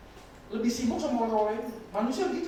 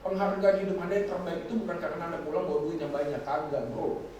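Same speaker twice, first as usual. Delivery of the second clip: ordinary talk, nothing marked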